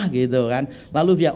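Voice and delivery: normal talking voice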